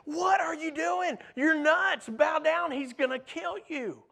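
a hint of pleading friends; friends' warning. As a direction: warn him like a worried friend. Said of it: "the friends who were saying".

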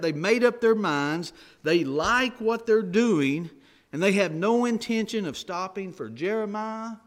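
preacher pressing the congregation on stubbornness, forceful; preaching. Acted. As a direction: drive the point home to the congregation. Preacher builds at full force.

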